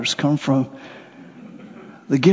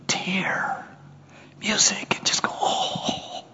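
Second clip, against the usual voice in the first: whisper; 3 semitones higher, 10 dB quieter, slower than usual. Acted. Savoring music in wonder.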